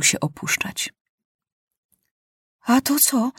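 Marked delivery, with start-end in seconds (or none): 2.6-3.3 higher, puzzled question; surprised. A narrator's reading. Maciuś startled as the plane jerks, surprised and higher. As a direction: sound caught off guard. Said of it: "zdziwił się Maciuś".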